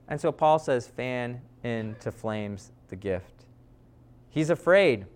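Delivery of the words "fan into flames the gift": much softer and slow; slow hushed command. Retold or imitated. Preacher closing on Paul's charge, slow and soft. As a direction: lay each word down gently.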